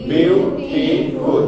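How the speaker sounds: "বিউটিফুল" very slow and loud, split as বিউ, টি, ফুল; broken into syllables. Shown as a demonstration. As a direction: call out "বিউটিফুল" in three separate long syllables. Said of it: "ভেঙে ভেঙে উচ্চারণ করতে হবে".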